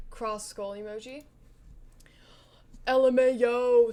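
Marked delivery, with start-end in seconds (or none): 2.9-3.9 very loud, mock-texting outburst; all-caps exclamation. Reading a friend's all-caps text reply, loud exclamation. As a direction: belt out the letters like all caps. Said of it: "L M A O, all caps".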